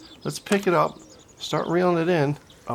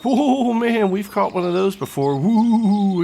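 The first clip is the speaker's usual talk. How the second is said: loud, raised-pitch amazement; excited whooping; 5 semitones higher, 5 dB louder, slower than usual. Real, not acted. Angler landing a rare ghost carp, loud excited whoop. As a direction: whoop over a rare catch.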